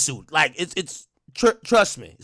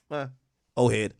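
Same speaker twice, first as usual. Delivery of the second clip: ordinary delivery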